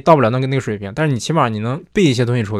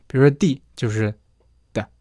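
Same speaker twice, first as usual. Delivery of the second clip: usual speaking voice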